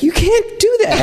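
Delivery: squeaky high laughing protest; laughing mock outrage; laughing disbelief. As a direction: blurt it out through a laugh. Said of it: "I was like".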